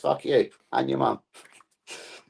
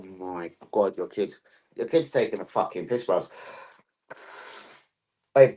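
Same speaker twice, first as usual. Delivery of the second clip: normal talking voice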